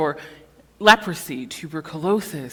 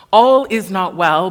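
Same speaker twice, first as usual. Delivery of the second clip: loud proclamation; 9 dB louder than usual. Acted. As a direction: declare it loud and strong. Sermon refrain, loud climax.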